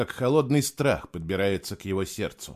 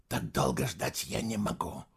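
softer, half-whispered complaint; whispering; 8 dB quieter than usual. Acted. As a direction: breathe it out quietly. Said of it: "прошептал он".

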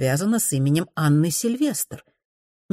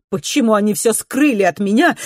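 plain reading voice

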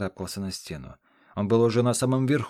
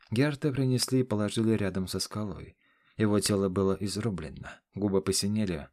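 usual speaking voice

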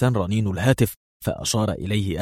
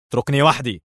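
higher-pitched, brusque dismissal; rough order; 5 semitones higher than usual. A narrator's reading. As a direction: send him away roughly. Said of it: "وقال لدان في خشونة".

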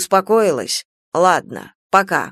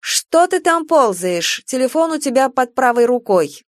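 higher, scolding wife; wife's voice; 5 semitones higher than usual. Acted. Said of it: "Потом вдруг женский голос". (голос жены, выше и с упрёком)

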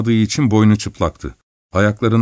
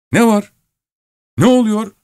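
higher, puzzled driver's voice; driver asking; 12 semitones higher, 4 dB louder than usual. Acted. Driver Ignat answering the miller in the storm, puzzled call. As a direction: call back what's wrong.

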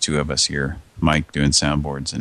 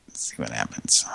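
whispering; 8 semitones lower, 9 dB quieter than usual. Real, not acted. Waiting quietly for the call.

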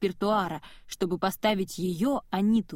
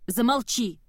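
snapping outburst; 3 semitones higher, 4 dB louder than usual. Acted. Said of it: "сорвалась Анита".

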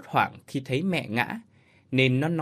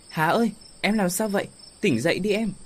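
alarmed husband; 7 semitones higher, faster than usual. Acted. Husband finds wife unconscious.

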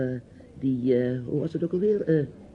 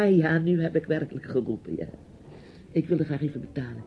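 ordinary talk, nothing marked

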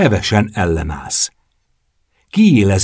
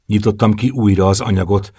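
ordinary delivery, nothing marked

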